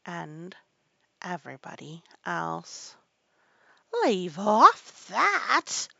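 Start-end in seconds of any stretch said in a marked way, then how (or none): 4.0-5.9 very slow, high, loud screech; screamed command. A narrator's reading. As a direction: scream it, stretching each word. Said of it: "screamed the Queen".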